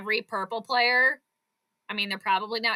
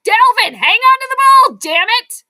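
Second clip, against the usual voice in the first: much higher, much louder yell; high furious shout at the TV; 11 semitones higher, 13 dB louder than usual. Real, not acted. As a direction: scream at the fumbling player. Yelling at player on TV.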